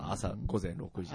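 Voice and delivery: slow, soft, low voiceover; deadpan TV narration. Shown as a demonstration. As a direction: read it like a gourmet show narrator. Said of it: "まあ、ナレーションみたいだもんね。".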